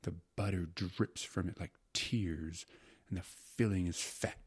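hushed, slow, sensuous gourmand; carried-away secretary. Acted. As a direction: savor each word as if tasting it. Secretary carried away describing meat pie.

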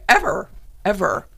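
usual speaking voice

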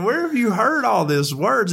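astonished questioning. Retold or imitated. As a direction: retell a parent's startled question. Dad questioning child in story.

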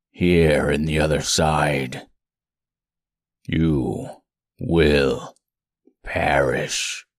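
very low, slow looming threat; deep menacing monster. Acted.

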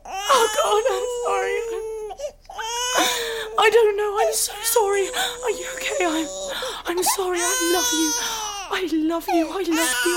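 high, breaking sorry; tearful apology. Acted. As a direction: apologize close to tears. Remorseful after shouting.

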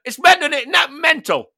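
high, loud delighted cackle of a question; loudly delighted bloke; gleeful. Retold or imitated. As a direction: revel in the joke. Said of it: "And he went".

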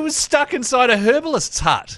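high, slow excited shout. Retold or imitated. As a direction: shout out the disbelief. Retelling game mishap excitedly.

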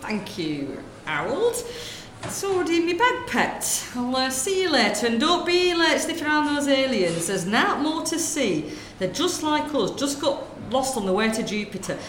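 lightly voiced gossiping northern woman, chatty; northern shop lady. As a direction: natter away like a corner-shop lady. Sketch, shopkeeper jokes.

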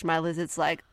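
ordinary delivery

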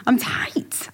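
breathy unvoiced whisper. Real, not acted. Joking to a friend about being stiff, whispered. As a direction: confide it under your breath.

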